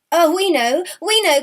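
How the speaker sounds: high, loud, flustered insistence; eager cry. A narrator's reading. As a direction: blurt it out, scrambling to sound sure. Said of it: "cried those two sisters".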